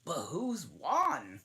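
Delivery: high, slow eager voice; high eager question. Acted. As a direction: ask it wide-eyed and high. Animals crowd asking dodo.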